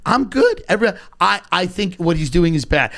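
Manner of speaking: ordinary talk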